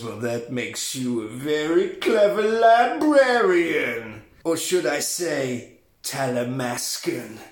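slow, drawn-out mock indignation; milk every word with imitated sass; offended and sassy. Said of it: "gets all offended and sassy".

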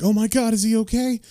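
high-pitched mock panic; lightly acted shocked concern; imitated alarm. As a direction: play a worried bystander, voice pitched right up. Driver voiced after hitting a man.

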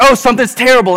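high, loud acted alarm; mock panic. New dad jumping at his crying baby, mock panic. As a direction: imitate an over-alarmed new parent.

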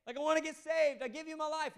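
high, loud, eager plea; mock fervent conversion. Imagined Nicodemus begs Jesus on his knees, mock fervent plea. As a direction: blurt it out like a hammed-up convert. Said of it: "falls on his knees like".

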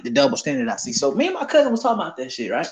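normal talking voice

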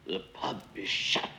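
low, breath-only whisper; hushed secrecy. A narrator's reading. Noah Bowen hushes the policeman in the shut pub, whispering.

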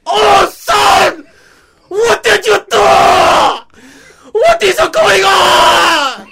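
high, loud, crying screams; screaming father. Acted. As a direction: wail in pain at your son.

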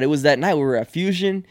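ordinary talk

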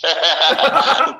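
a quick, real burst of laughter; short laugh. Real, not acted. Caller laughs at being teased.

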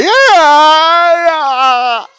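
high loud cartoonish yeah yeah; mock Jim Carrey yelp.

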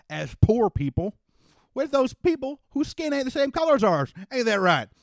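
a lightly voiced Texan father, rousing the crowd; folksy rally speaker. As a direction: speak up like a country politician winning over men. Daddy's rousing speech climax.

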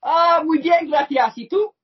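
high, loud mock-Spanish muy bien; Spanish reply. Host playing along in Spanish, hammed-up high Spanish. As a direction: chirp it back in hammed-up Spanish. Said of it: "can you speak Spanish".

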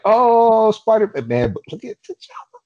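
a high, excited gasp of delight; excited fan. Retold or imitated. Fan recalling seeing T'Challa appear, excited and high. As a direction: squeal it like a thrilled fan at the screen. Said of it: "and I was like".

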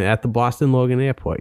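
put-on Boston accent, r dropped in Airport; playful Boston accent. Shown as a demonstration. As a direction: say it like a Boston local. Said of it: "Talk in your Boston accent".

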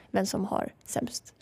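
whispering who the worst loser is; conspiratorial whisper. Real, not acted. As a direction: lean in and whisper it. Gossiping about a teammate.

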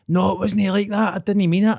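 high, pleading mock voice of a frightened man; lightly voiced scared bloke. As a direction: imitate him begging off.

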